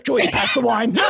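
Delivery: laughing mock dad voice; dad calling for wine. Dad asks Joyce for wine, mock rattled. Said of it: "He's like".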